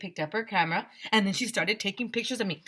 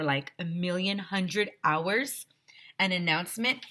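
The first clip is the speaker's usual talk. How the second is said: plain reading voice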